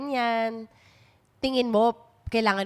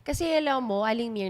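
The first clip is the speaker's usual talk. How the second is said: usual speaking voice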